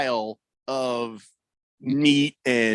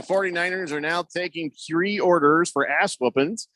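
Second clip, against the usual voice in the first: ordinary talk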